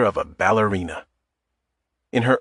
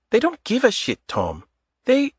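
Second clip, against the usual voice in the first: put-on conflicted, higher voice in a light Eastern European accent; torn, light Eastern European accent; 13 semitones higher than usual. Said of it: "Torn, Adita said".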